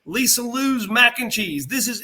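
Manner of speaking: a touch of a thrilled wrestler; delighted wrestler. Retold or imitated. Wrestlers backstage over homemade food, mock delighted gushing. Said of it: "go in the back and go".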